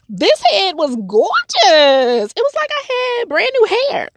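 gushing, high and thrilled; excited. Real, not acted. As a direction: squeal about gorgeous new hair. Gushing about her hair.

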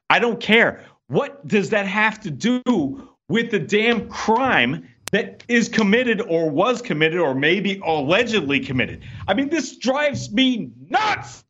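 exasperated outburst, pitch climbing; freaking out. Real, not acted. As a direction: vent real frustration, stretching the key words. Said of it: "I don't mean to freak out".

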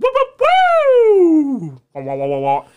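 rising whoop then wailing wah; police siren imitation. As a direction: mimic a cop siren with your voice. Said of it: "red and blue lights go".

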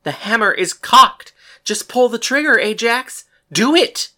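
high, very loud goading; shouted command. A narrator's reading. Gunslinger orders Ajax to pull trigger, shouting. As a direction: shout the order, pushing for immediate action. Urging him to fire the gun.

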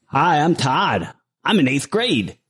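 impression of an eighth-grade classmate introducing himself; high, slow schoolkid voice; Todd. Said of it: "Here is Todd from my eighth grade".

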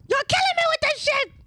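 very high-pitched squeal; mock screech. Testing mic levels with a friend's line, mock screeching.

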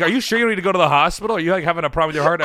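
rushed, concerned cab driver; worried taxi driver. Retold or imitated. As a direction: rattle off the worried questions like a panicking cabbie. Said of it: "he's like".

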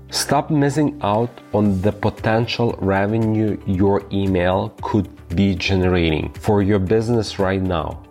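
ordinary talk

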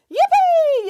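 very high, joyful yippee; gleeful yell. Acted. Nanny Piggins falling from tree, gleeful high yell. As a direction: whoop with delight as you fall. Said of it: "yelled Nanny Piggins".

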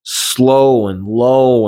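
very slow drawn-out words; imitating weak slow speech; slow and low. Shown as a demonstration. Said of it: "he talks so".

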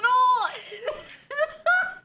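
high shrieking laugh. Real, not acted. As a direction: cackle at the memory. Laughing at themselves.

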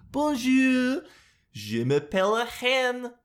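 high, slow mock French hen; French hen accent. Vlogger voicing a French hen, high mock accent. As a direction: squawk out bonjour like a hen. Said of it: "what a French hen sounds like".

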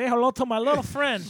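hammed-up gangster movie line; movie quote voice. Man quoting Scarface while waving candy, hammed-up gangster voice.

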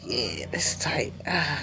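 soft unvoiced whisper to himself; a hushed, pleased aside. Real, not acted. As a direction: whisper it like a private thought. Muttering aside to self.

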